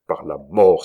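sudden loud outburst of fury; furious exclamation. A narrator's reading. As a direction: exclaim it loudly in anger. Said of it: "s'écria-t-il".

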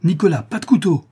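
loud, high warning shout; urgent cry. A narrator's reading. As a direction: cry out to stop him. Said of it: "s'écria la veuve".

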